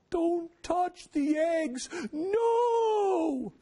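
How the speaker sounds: high, slow falsetto wail; hammed-up horror, stretching into a long cry; mock panic. Mock panic at farm rules.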